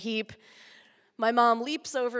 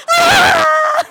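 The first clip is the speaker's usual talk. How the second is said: shrill piercing scream, very high and loud; mom's scream; 18 dB louder than usual. Retold or imitated. Imitating mom screaming as the van drags her kid. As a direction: mom screaming in terror, hammed up. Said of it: "She's like".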